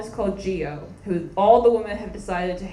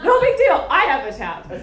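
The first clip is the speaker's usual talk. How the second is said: mock Alexandria; 5 semitones higher, 6 dB louder than usual. Mocking Alexandria's water maker.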